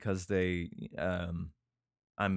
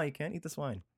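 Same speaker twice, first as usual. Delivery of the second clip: normal talking voice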